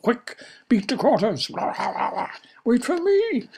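high frantic calls. Acted. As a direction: shout while fleeing. Fleeing eruption.

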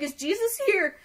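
high-pitched astonished question; retold shock in a high voice; startled disbelief. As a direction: pitch it right up, incredulous. Retells her startled question, repeated.

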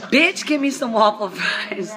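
slow, loud sassy demand; imitating a viral video. Mimicking a viral YouTube clip, slow and sassy. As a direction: channel the YouTube guy.

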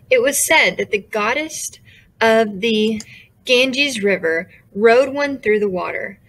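ordinary delivery, nothing marked

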